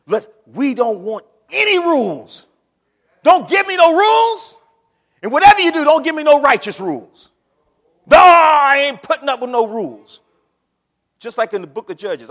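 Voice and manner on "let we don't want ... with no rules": loud mocking shout refusing any rules; defiant heathen shouting. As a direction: bellow it like a rebel throwing off every rule. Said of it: "They say".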